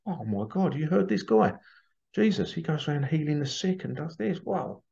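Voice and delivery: hushed, high-pitched wonder, lightly acted; mock amazement. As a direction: play an astonished bystander passing on news. Voicing an amazed crowd about Jesus.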